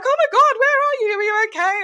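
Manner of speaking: very high-pitched mock panic; worried friends. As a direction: imitate friends fretting over someone missing. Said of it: "they're getting worried".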